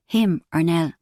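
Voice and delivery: ordinary delivery, nothing marked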